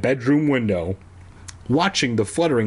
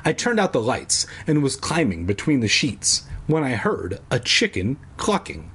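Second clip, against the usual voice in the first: ordinary delivery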